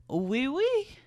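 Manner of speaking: high, light mock-French accent. Boyfriend accepting soup, playful French accent. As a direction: pitch it up in a fake French accent. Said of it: "French accent".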